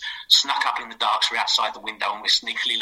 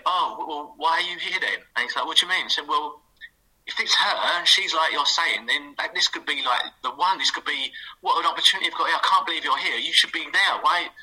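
higher, pushy fake enthusiasm; mock urgent excitement; 6 semitones higher than usual. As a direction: hype him up to rush back to the gym. Urging Stuart back to gym.

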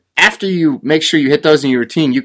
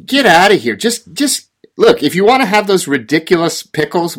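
outraged dismissal; 6 semitones higher than usual. Real, not acted.

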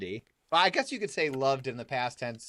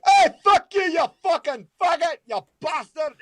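high, loud shouted insults at a friend; high comic shouting; mock road rage yell; 7 dB louder than usual. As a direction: yell across the traffic light for fun. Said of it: "I'm like".

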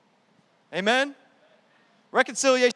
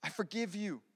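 quiet, softer forgiving words; soft forgiveness; 3 semitones lower, 11 dB quieter than usual. Acted. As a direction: forgive them gently. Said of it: "He says".

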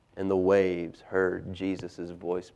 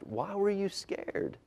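softer, higher, slow questioning; gentle question; 10 semitones higher, 5 dB quieter, much slower than usual. A narrator's reading. Jesus asking his storm-torn friends, gentle question. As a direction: ask it softly and unhurriedly.

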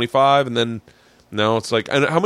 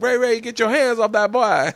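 put-on high scolding old grandma; high-pitched grandmother calling out; 10 semitones higher than usual. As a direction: shout it like a grandma on the porch. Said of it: "grandma comes out, she's like".

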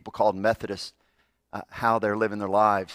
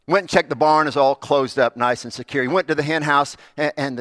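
louder, higher, fast storytelling; full-force preaching story; 6 semitones higher, 8 dB louder than usual. Acted. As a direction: tell it like a revival preacher.

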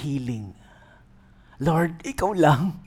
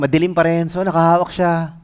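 normal talking voice

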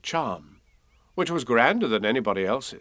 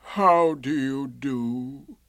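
very slow gloomy greeting; Eeyore; much slower than usual. A narrator's reading. Eeyore greeting Pooh, gloomy. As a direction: drag it out like a dismal donkey. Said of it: "in a gloomy manner to him".

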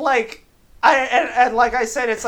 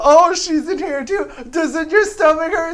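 higher, mock-sweet taunting; condescending; 7 semitones higher, 3 dB louder than usual. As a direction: tease with fake concern. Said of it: "that condescending".

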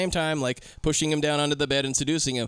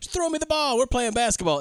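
high, showy call for the ball; mock boastful pimp; 7 semitones higher than usual. Pimp showing off to journalist, mock boastful. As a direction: show off for the journalist. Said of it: "He's like".

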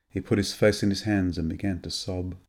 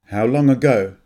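curt, louder demand; abrupt; 3 semitones higher, 7 dB louder than usual. Acted. Anne interrogates Graham, abrupt and curt. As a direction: she fires the question at him. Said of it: "she asked abruptly".

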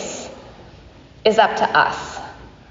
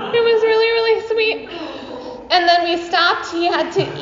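laughing; 9 semitones higher than usual. Real, not acted. Touched by son's remark.

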